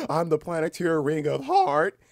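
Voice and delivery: loud, high, drawn-out disbelief after a gasp; mock astonishment. New Planeteer learns their ring, mock astonished. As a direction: gush it slowly, hammed-up wonder. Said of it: "And I'm like".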